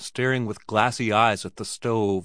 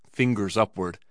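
ordinary delivery, nothing marked

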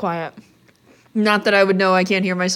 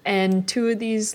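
slow, savoring list; drawn-out menu rundown; slower than usual. Real, not acted. Slowly lists lunch items.

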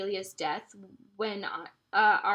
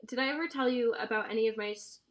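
ordinary talk, nothing marked

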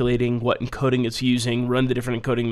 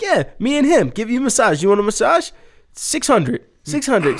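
much higher broken-English sales pitch; mock hustler pitch; 9 semitones higher than usual. Speaker offering the masseuse a massage for money, hammed-up hustler. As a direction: sell the massage back to her.

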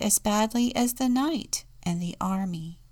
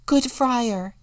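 louder, higher cut-in; interrupting; 5 semitones higher, 7 dB louder than usual. A narrator's reading. Contessa cutting off Saint Francis, interrupting. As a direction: break in on the friar. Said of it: "interrupted the Contessa".

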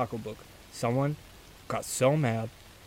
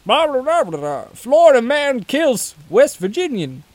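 high, loud mock headline read; news anchor voice; 12 semitones higher, 7 dB louder than usual. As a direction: announce it like a tabloid headline.

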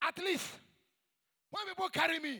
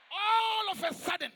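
high, loud preacher's burst; sudden exclamation; 6 semitones higher, 4 dB louder than usual. Acted. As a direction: burst out on it, stretching the first word. Preacher bursts out after amen call.

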